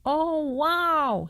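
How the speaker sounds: high gasp of shock. A narrator's reading. Dentist gasping inside the fox's mouth, high. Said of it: "he gasped".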